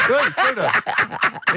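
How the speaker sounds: laughing slowly through it; slow, laughing mock greeting. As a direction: drawl it out while laughing. Mock addressing small man.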